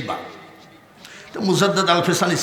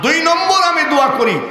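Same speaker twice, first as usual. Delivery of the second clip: high, sing-out appeal to the crowd; fundraising call; 11 semitones higher, 4 dB louder than usual. Real, not acted. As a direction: call out for the next donor, high. Preacher calls out for donors.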